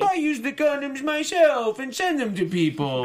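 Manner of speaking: a hammed-up [name] boast; mock [name]. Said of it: "he's like".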